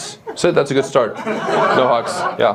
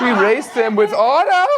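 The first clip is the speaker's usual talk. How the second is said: mock German drill voice; 8 semitones higher than usual.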